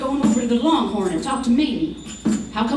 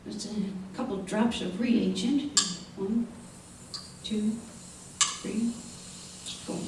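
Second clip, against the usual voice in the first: quiet counting; 3 semitones lower, 11 dB quieter than usual. Acted. Doc counting drops carefully.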